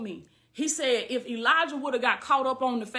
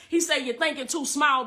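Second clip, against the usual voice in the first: ordinary talk, nothing marked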